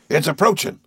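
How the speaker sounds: thrilled and frustrated at the whale; excited. A narrator's reading. Harpooner watching the whale come close, excited. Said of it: "his voice full of excitement".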